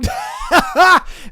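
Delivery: loud high burst of laughter; real laugh, high and loud. Real, not acted. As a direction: cackle at the punchline. Laughs at own joke.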